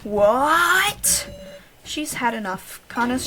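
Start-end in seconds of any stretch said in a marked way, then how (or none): 0.0-1.3 high, loud rising disbelief; shocked "What?". Real, not acted. Player shocked that Kana wants to die, loud. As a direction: blurt it out in shock.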